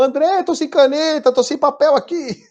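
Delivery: high mock complaint from students; whiny students. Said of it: "aí eu comecei a falar e todo mundo". (alunos reclamando, voz aguda de imitação)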